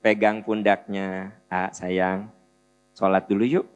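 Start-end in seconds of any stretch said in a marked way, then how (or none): 1.4-3.7 soft, low coaxing voice; gentle mother. Retold or imitated. Mother gently coaxing her child to pray. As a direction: coax the child tenderly, hushed. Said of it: "Tapi kalau ibu deketin, pegang pundaknya".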